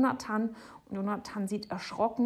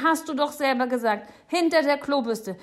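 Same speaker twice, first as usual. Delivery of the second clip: high, loud, insistent shout; 7 semitones higher, 9 dB louder than usual. A narrator's reading. Boy reminding the neighbour what she said, shouting. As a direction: shout it like an accusing child. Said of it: "schreit Jonathan".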